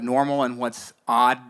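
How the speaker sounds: ordinary delivery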